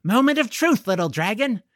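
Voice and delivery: put-on high, loud and eager, a boy about to test his secret; excited. A narrator's reading. Boy to his new dragon, giddy and excited. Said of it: "clapped his hands together with excitement".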